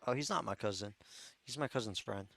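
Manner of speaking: ordinary talk, nothing marked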